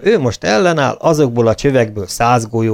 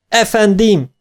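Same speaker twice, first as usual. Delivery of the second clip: called out; 10 semitones higher, slower than usual. A narrator's reading. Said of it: "kiáltotta".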